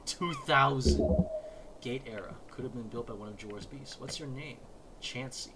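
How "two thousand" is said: loud slow booming answer; slow wall voice. Acted. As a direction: boom it out slowly.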